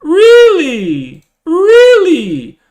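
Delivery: high, loud disbelieving Really; incredulous squeal. Real, not acted. Streamer outraged at a hater's comment, high incredulous squeal.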